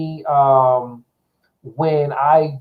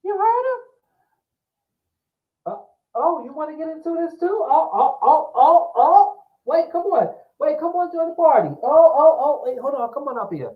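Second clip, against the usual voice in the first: talking to a pet; 12 semitones higher than usual. Real, not acted. Talking to cat.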